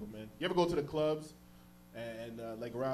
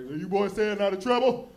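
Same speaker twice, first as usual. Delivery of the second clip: stern cop; 5 semitones higher, 10 dB louder, slower than usual. Retold or imitated. Cop questioning kids.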